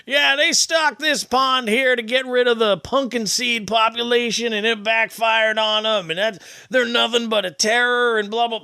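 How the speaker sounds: sustained high-pitched imitation of a local; put-on high voice, running on; drunk fishing regular. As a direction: mimic a pond regular's rant. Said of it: "He's like".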